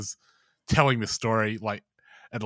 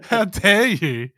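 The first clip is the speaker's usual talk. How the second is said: mock outrage; 7 semitones higher, 6 dB louder, much slower than usual. Voicing outraged Atlantis believers.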